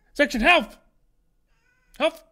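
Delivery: sharp, high, loud command; shouting at pet. Real, not acted. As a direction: yell at the pet to stop. (Haustier anschreien)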